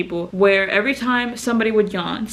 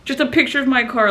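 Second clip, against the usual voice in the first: usual speaking voice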